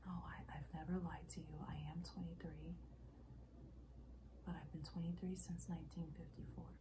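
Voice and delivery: soft, hushed and restrained; subdued confession. Acted. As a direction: confess it quietly, holding back. Acted monologue, subdued first take.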